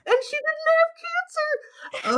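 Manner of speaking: extremely high, drawn-out protest; exasperated squeal. Real, not acted. Correcting the game's cancer story, exasperated squeal. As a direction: squeak it out slowly in disbelief. Outraged at the game's plot twist.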